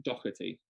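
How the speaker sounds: Doherty said as DOCK-er-tee; local pronunciation. Shown as a demonstration. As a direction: say Doherty with a hard k in the middle. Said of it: "We would probably say".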